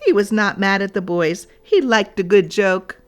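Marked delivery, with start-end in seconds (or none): none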